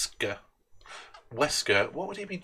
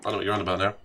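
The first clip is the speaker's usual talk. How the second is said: ordinary talk, nothing marked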